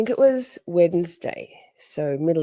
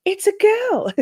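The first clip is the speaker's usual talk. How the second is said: high-pitched gleeful announcement; acted-out thrilled proclamation; retold delight; 4 dB louder than usual. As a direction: squeal it proudly, breaking into laughter. Retold delight holding up the dog.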